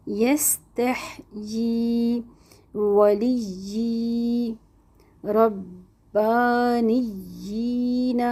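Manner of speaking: یستحیی ولی ربانیین with vowels held two beats, very slow; elongated Quranic recitation. Shown as a demonstration. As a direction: stretch each long vowel deliberately. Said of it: "دو دو حرکات کھینچنا ہے". (کھڑی حرکات دو حرکہ کھینچ کر)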